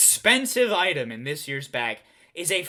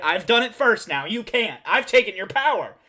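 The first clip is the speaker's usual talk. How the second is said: ordinary talk, nothing marked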